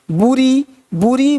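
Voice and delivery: "बुरी" with an oo after b; wrong pronunciation. Shown as a demonstration. Teacher shows another mistake in bury, "बुरी" with oo. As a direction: say "बुरी" like "boo-ree". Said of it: "ब्यूरी नहीं".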